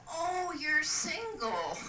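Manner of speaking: high, drawn-out flirtatious coo; delighted interest. Acted. Lady learning the man is available, delighted. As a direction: swoop up high and linger, thrilled.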